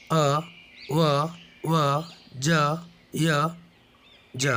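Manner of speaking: each letter sound said alone, with a pause after each; letter sounds recited. Shown as a demonstration. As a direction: recite the sounds one by one for the class: "अ", "ब", "स". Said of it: "इसको हम पढ़ें". (हर अक्षर की ध्वनि रुक रुक कर अलग अलग बोलें)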